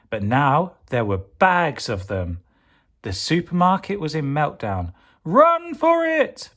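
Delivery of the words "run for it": very high, slow panicked yell; panicked cry. Acted. Pepper fleeing the meltdown, high panicked yell. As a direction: shriek it in a panic. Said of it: "panicked Pepper".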